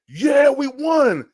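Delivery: high, slow, drawn-out triumphant cry; excited celebration. Retold or imitated. Panthers fan gloating at the Patriots fans, with a slow high cheer. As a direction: savor the win out loud, high and slow. Celebrating the interception among rivals.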